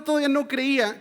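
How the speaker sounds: ordinary delivery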